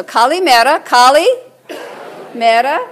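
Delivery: "kalimera" whole, then in two parts, "kali", "mera"; teaching Greek pronunciation. Shown as a demonstration. Preacher leading congregation through "kalimera" piece by piece, teaching pronunciation. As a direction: break "kalimera" into pieces for others to repeat. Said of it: "Let's try those words".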